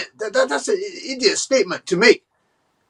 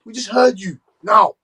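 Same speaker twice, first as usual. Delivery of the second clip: ordinary delivery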